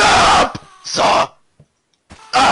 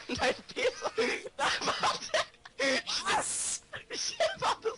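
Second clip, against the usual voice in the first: helpless laughter; 11 semitones higher, 13 dB quieter than usual. Real, not acted.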